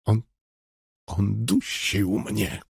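slow, soft, halting plea; quiet stammering plea; pleading stammer. A narrator's reading. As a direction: beg through a bruised throat, slowly. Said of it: "błagalnie wyjąkał".

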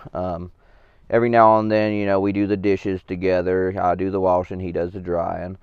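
low, earnest testimonial voice; sincere interview. Acted. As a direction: speak like a proud mentor on camera. Mock sincere interview about Phil.

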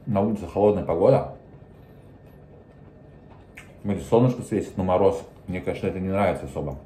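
food in mouth; chewing while talking. Real, not acted. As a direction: mouth partly full. Seen 0: bite taken at -2.0; lips work as if chewing while speaking.

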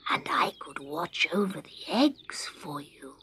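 soft unvoiced whisper. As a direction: whisper it gently to the bird. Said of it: "whispered Scarecrow".

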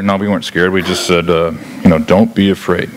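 low, understated denial; shepherds downplaying fear. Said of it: "I'm sure the shepherds, when they're telling this story later".

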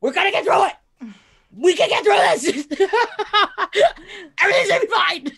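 high, loud, laughing pep talk; forced cheerful reassurance. Retold or imitated. To her crying mom, loudly insisting all will be fine. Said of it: "I was like".

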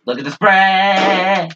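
high, slow, drawn-out outrage; hammed-up outrage at the spray; mocking exclaim. Real, not acted. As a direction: stretch it out in disbelief.

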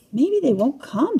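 lightly acted fretful musing; worried thought. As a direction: think it aloud with a touch of worry. Stanley worries while waiting alone.

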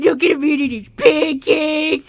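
slow, doting look-at-him voice; quoted cooing. Retold or imitated. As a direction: gush over him slowly. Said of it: "I said".

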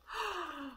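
a quick quiet breath of delight; soft delighted gasp. Real, not acted. Reviewer spotting the zipper flap, soft delighted gasp. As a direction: gasp as the detail surprises you.

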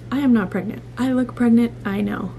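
ordinary talk

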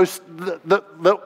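real stumbling over words; tongue-tied. Real, not acted. Preacher losing the thread mid-story, tongue-tied. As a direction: trip on the start and stall, voice dropping. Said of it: "I can't talk".

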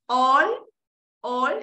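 "All" held long on the vowel; lengthened syllable. Shown as a demonstration. Teacher showing the lengthening mark, long "All". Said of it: "esto es para alargar la sílaba".

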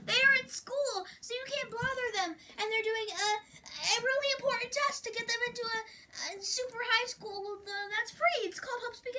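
high, loud flustered protest; frantic excuse. Acted. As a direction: blurt out a desperate cover story. Panicked cover story.

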